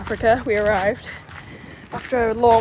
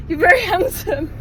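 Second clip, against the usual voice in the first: laughing quote; 8 semitones higher, 6 dB louder than usual. Retold or imitated. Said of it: "pointed to Dan and said".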